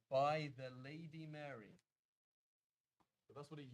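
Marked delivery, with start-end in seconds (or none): 0.1-1.8 louder, slow and deliberate; measured, emphatic pronouncement; slow reveal. Shown as a demonstration. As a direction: announce each word slowly and firmly. Reveals the old full phrase.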